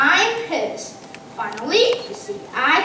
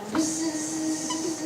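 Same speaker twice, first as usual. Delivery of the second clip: fly buzz; 9 dB quieter than usual. Acted. Fly lands on his waffles.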